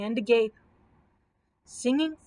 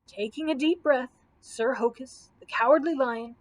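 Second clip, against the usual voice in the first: usual speaking voice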